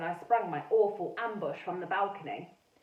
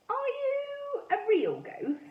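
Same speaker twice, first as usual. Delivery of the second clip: put-on nervous girl; much slower than usual. Girl nervously asks a ghost.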